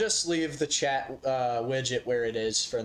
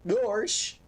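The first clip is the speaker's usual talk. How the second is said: cartoon goofy voice; 8 semitones higher than usual. Goofy-style exclamation.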